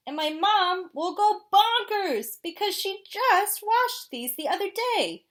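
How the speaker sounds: high, loud squawking complaint; outraged penguin scolding; indignant penguin voice. Acted.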